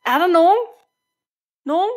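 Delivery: loud searching call, rising. Acted. Lonely voice calling after the departing flock, loud. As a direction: shout into the empty woods for anyone.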